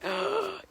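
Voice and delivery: sharp high gasp of delight; a quick thrilled gasp; excited gasp. Real, not acted. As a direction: catch your breath at the news. Reacting to pickle tip.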